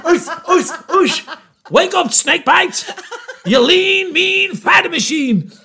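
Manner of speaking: slow, pumped-up boxer psych-up; self pep talk. Retold or imitated. Psyching himself up in the toilet.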